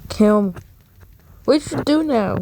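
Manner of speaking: ordinary talk, nothing marked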